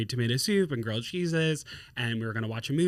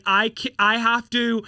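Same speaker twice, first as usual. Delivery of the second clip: higher, louder acted exasperation; lightly voiced overwhelmed witch; 6 semitones higher, 6 dB louder than usual. Etsy witch swamped by requests, mock overwhelmed. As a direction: plead for a break, mildly hammed-up. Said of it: "she was being a responsible witch".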